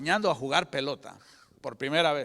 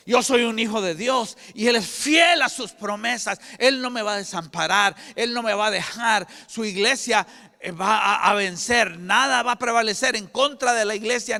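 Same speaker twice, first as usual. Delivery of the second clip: bold declaration of faith; 5 semitones higher, 6 dB louder than usual. Pretend.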